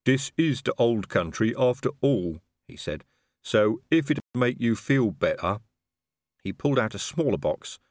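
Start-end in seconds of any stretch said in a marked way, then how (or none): 0.0-2.4 slow troll voice, accepting; placid, plodding troll. Acted. 3.4-5.6 slow troll voice, accepting; placid, plodding troll. Acted.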